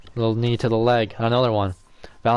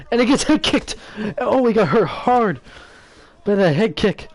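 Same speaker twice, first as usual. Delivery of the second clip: excited; 10 semitones higher than usual. Real, not acted. Head kick lands hard.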